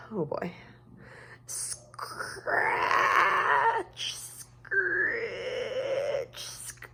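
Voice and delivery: soft, eerie scratching sounds; hushed spooky night. A narrator's reading. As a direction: hush the noises like a scary secret. Spooky night noises at window.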